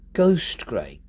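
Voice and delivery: GHOST punched, grey falling away; "ghost" emphasised, "grey" unstressed; stress on GHOST. Shown as a demonstration. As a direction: lean on "ghost" and let "grey" drop. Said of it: "Which words would you naturally emphasise?".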